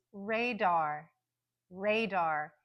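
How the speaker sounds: radar with strong RAY and clear, full DAR; careful radar, both syllables stressed, first stronger; secondary stress. Shown as a demonstration. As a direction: keep the second vowel of radar unreduced. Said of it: "we hear the very clear dar".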